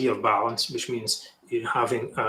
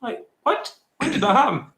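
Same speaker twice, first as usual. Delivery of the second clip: incredulous; 10 semitones higher than usual. Real, not acted. Mock disbelief at article.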